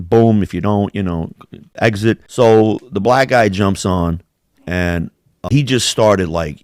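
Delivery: ordinary delivery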